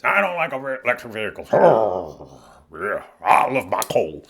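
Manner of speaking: put-on voice of an older grumbler; mock a stubborn older sister who hates change; woman in her sixties. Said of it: "she's in her sixties".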